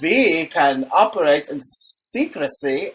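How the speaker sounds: put-on high, drawn-out German accent; hammed-up German accent, words stretched. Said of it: "do it in the German voice".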